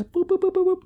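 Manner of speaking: very high, squeaky boop noises; chirpy robot boops; playful boops. Pretend. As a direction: bounce each boop up like a cartoon beep. Playful beeps while pointing listeners.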